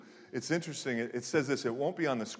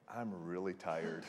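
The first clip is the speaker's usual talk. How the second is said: put-on tired kid; 6 semitones lower, 9 dB quieter than usual.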